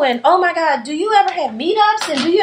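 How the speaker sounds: high, eager gushing; excited fans. Retold or imitated. Readers discovering the blog, high excited gushing. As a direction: gush like a fan finding her people. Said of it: "they was like".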